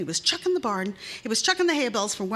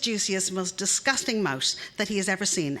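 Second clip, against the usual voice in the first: plain reading voice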